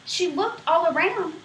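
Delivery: ordinary delivery, nothing marked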